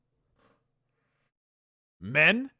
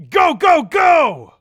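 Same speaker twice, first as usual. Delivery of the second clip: much higher, loud go go go; battle shout; 8 semitones higher, 10 dB louder, slower than usual. Acted. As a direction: roar the troops forward. Ordering the attack.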